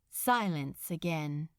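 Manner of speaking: usual speaking voice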